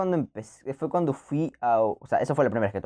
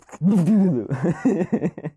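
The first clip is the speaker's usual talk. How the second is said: choked laugh; 4 semitones higher, 4 dB louder than usual. Retold or imitated. Reacting to first sip.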